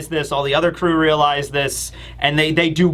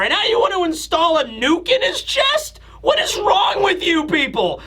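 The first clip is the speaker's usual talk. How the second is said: much higher, outraged ranting shout; exasperated Vegapunk; 11 semitones higher than usual. Acted. Vegapunk refusing Saturn's self-destruct demand, furious high rant. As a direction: play Vegapunk fed up and yelling back.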